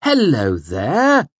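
high, loud, slow, syrupy false politeness; over-courteous. A narrator's reading. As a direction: lay on the fake courtesy. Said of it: "in an over-courteous tone".

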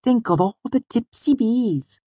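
very high, slow wondering voice; Auri. A narrator's reading. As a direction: muse slowly in a dainty high voice. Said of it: "She peered down at it wonderingly".

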